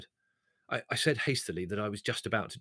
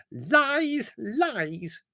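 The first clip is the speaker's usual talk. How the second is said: high, loud shriek; shrill cry; 14 semitones higher, 7 dB louder than usual. Acted. The maid, tearful, shrieks at the turkey owner: high loud Lies. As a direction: shriek it in outrage. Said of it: "shrilled Lugorezia".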